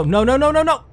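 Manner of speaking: high, loud panicked cries. Real, not acted. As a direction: panic as the crash comes. Crash coming.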